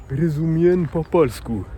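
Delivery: "rozumiem po polsku" spoken slowly, each syllable placed; slowed-down demo of "rozumiem po polsku"; slow careful Polish. Shown as a demonstration. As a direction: spell out "rozumiem po polsku" for a learner. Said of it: "tu vas dire".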